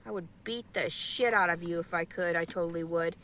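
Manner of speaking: normal talking voice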